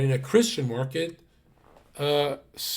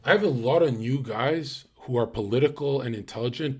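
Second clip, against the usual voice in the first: usual speaking voice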